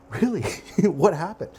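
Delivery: high, loud laughing questions; laughing disbelief. Acted. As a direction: laugh out the shock. Diner laughs at news.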